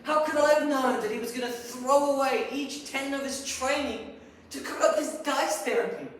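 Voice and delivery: loud, high-pitched, aggrieved question; outraged rant. Acted. Psychologist defending himself over his colleague's madness, outraged. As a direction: protest at full voice, incredulous. Ranting at former colleague's betrayal.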